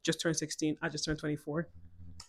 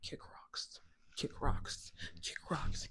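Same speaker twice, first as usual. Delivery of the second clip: softer, whispered kick rocks; whispered dismissal; 5 semitones lower, 10 dB quieter, much slower than usual. Real, not acted. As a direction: hiss it under your breath. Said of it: "kick rocks if you have a problem with it".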